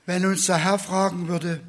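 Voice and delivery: ordinary delivery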